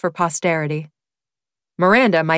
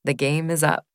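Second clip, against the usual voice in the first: soft murmur; 2 semitones lower, 5 dB quieter than usual. A narrator's reading. Narrator quietly admits defeat to her friend, soft murmur. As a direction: say it quietly, almost to yourself. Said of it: "I murmured".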